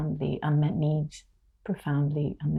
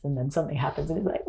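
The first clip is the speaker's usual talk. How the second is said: normal talking voice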